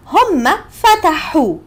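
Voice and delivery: فتحوا with a long, clear final u; Egyptian Arabic demo. Shown as a demonstration. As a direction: stress هم, then فتحوا ending in u. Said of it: "The suffix is O".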